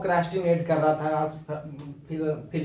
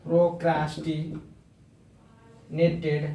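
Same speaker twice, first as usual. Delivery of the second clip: very slow word; much slower than usual. Shown as a demonstration. Teacher modelling procrastinated for students, very slowly. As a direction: stretch procrastinated out syllable by syllable, very slowly. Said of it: "आप इस तरीके से use करते हैं".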